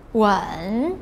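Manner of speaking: "wen" dipping low, then rising; third tone. Shown as a demonstration. Teacher running all four tones in sequence, "wen" falling then rising. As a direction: sink "wen" down, then scoop it up. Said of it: "in all of the four tones in sequence".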